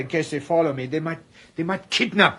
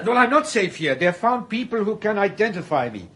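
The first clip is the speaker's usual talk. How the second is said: anxious protest; 6 semitones higher than usual. Acted. Fugitive protesting his danger.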